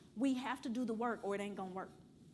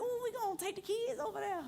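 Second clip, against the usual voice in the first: mock eager; 10 semitones higher than usual. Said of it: "I was like".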